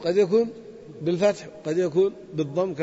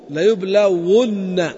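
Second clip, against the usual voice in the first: slow model pronunciation; 4 dB louder, much slower than usual. Shown as a demonstration. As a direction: drag out يبلى ون syllable by syllable. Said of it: "إذن نقول". (نطق بطيء مع تشديد النون في يبلى ون)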